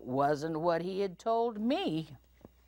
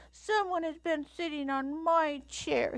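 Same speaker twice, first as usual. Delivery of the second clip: very high, fussing little bear; baby bear voice; 14 semitones higher than usual. A narrator's reading. Little Bear fusses over his chair, high baby voice. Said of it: "he said in his baby voice".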